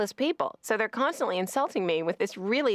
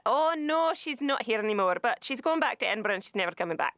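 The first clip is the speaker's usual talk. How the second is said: bad Scottish accent; 4 semitones higher than usual. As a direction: put on a hammed-up Scottish accent. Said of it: "like, some really bad, you know, Scott".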